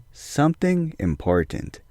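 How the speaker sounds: slow, soft and ominous; hushed suspense. Acted. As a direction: let it hang, hushed and slow. Suspense build in story.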